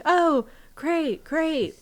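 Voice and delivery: high, loud put-on enthusiasm; loud mock-cheerful reply. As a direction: play up how great it is, loudly. Said aloud before a whispered aside.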